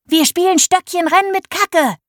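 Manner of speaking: put-on high, gleeful girl's cry; excited shout. A narrator's reading. Millie telling everyone the poo race plan, high excited cry. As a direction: announce the game with glee. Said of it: "rief sie".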